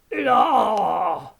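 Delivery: sudden loud pirate cry; pirate yell. Acted. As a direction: burst out with a hearty pirate yell. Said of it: "Sorry about that".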